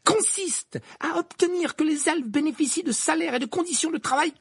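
very high, strident-pitched speechifying. A narrator's reading. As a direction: drown out the objection without stopping. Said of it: "d'une voix encore plus forte". (d'une voix forte, en militante indignée)

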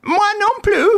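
high-pitched squeaky companion; thin high character voice; Wilson, injured sidekick. Acted. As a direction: pipe up in a high, put-on voice.

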